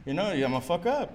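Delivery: usual speaking voice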